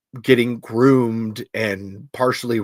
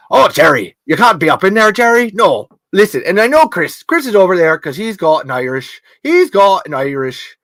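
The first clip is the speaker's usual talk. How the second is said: put-on loud, high Irish accent, scolding Jerry; an Irish father ranting at Jerry; 6 semitones higher, 6 dB louder than usual. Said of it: "I gotta think of the impression".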